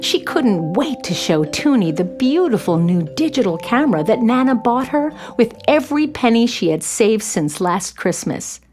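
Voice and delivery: ordinary delivery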